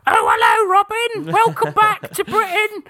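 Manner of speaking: hammed-up English accent, high at the end; a hammy film Englishman greeting Robin in an English accent; mock English accent. Film side character welcomes Robin home, mock English accent. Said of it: "other characters are going".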